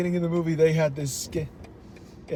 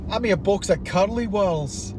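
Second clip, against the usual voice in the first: lilting put-on Scottish; Scottish accent; 3 semitones higher than usual. Shown as a demonstration. Friend asks to hear Scottish, Scottish accent. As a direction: roll out a broad Scottish accent. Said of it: "Wait, do Scottish".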